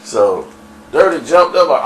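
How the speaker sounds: ordinary talk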